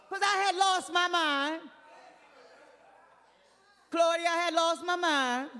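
half-laughing confession of folly; exclaiming. Real, not acted. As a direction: cry it out to the crowd.

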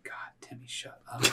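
hissed, whispered rebuke; whispered scolding. Acted. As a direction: whisper it sharply at the kid. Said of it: "hear the mom saying".

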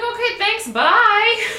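very high, loud mock farewell; sing-out goodbye, making a quick exit; hammed-up breezy goodbye. Ditching the man once the joints are rolled, hammed-up goodbye.

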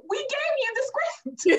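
high, slow exasperated protest; exasperated viewers. Retold or imitated. Viewers groaning at husband, high and exasperated. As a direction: stretch it out in mock disbelief. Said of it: "And we're like".

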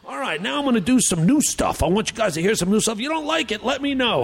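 put-on high, swooping old stand-up patter; hammy veteran comic impression; Rodney. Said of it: "He goes".

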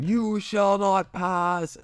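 high, slow dramatic wizard cry; Gandalf. Retold or imitated. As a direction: stretch every word into a mock-epic command. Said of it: "like Gandalf going".

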